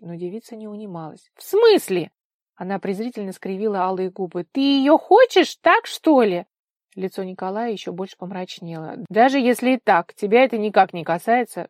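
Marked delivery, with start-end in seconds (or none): 1.4-2.1 high, loud, incredulous challenge; scornful indignation. A narrator's reading. Vika scornful, quarrelling. 4.5-6.4 loud, high, drawn-out indignant question; scornful accusation. A narrator's reading. Vika scornful, quarrelling. 9.1-11.7 loud, stern rebuff; harsh cut-off. A narrator's reading. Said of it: "жёстко обрубил он".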